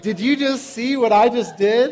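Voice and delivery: imitating a kid's gleeful boast through laughter; gloating son. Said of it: "he turns to his friend and he goes".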